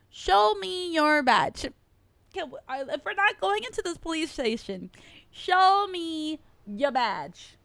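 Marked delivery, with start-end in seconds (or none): 0.1-1.7 loud slow demand. Pretend. Repeats demand louder, slower. 5.3-7.6 drawn-out loud demand. Pretend.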